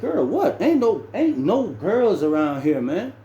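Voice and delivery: high-pitched, incredulous objection; mock-incredulous outburst; retold disbelief. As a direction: act out baffled protest, pitch jumping up. Retold incredulous reaction to girl talk.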